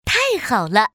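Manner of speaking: ordinary delivery, nothing marked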